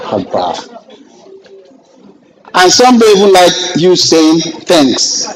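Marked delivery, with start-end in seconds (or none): 0.1-0.8 soft, low haba of disbelief; exasperated aside. Real, not acted. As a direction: mutter it in disbelief. Exasperated exclamation.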